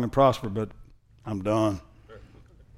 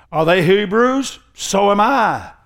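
slow, high mock boast; raised, emphatic boastful reading; fool's boasting; 7 semitones higher, 6 dB louder, much slower than usual. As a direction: brag like a fool, drawing out each word. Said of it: "This is how fools talk".

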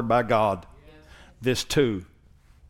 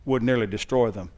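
full-force preaching; 2 semitones higher, 5 dB louder than usual. Acted. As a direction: preach it with rising intensity. Preaching builds again.

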